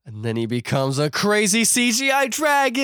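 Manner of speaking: building to a high squeal; incredulous excitement. Real, not acted. Incredulous climax of the retelling.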